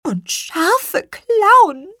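high, slow, loud squeal; dramatic high chicken voice; squeaky hen. Acted. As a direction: draw out the horrors in a hen's squeak. Hen describes the monster.

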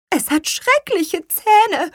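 high, slow, loud squeal; dramatic high chicken voice; squeaky hen. Acted. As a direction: draw out the horrors in a hen's squeak. Hen describes the monster.

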